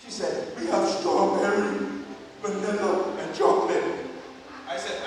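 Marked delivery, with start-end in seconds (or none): 0.6-3.8 slow, mock-laryngitis menu listing; imitated hoarse drive-thru worker; hoarse intercom voice. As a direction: labour through each flavour in a put-on hoarse voice. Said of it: "After hearing her voice".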